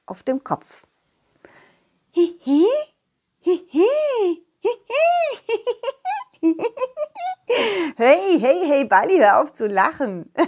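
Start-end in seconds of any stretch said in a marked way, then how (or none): 2.1-4.5 very high squeaky giggle; tiny creature giggle. Acted. Balli giggles from under the pot, very high and squeaky. 4.6-7.9 very high tiny laugh; squeaky creature laugh. Acted. Balli laughs at the dog with the pot on its head. 8.0-10.3 laughing, louder "hey hey"; playful laughing protest. Acted. The dog tells laughing Balli to stop, laughing too.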